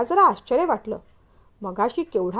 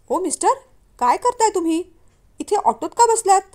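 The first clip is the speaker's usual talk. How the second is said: sharp, raised, annoyed questioning; angry; 6 semitones higher, 5 dB louder than usual. A narrator's reading. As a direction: confront the stranger sitting in her auto. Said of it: "तिने रागाने विचारलं". (रागावलेला, चढा आवाज)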